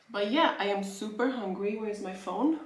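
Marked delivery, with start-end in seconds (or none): none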